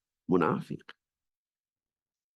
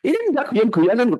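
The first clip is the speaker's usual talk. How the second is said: high mock protest; 11 semitones higher, 4 dB louder than usual. Quoting high-pitched protest.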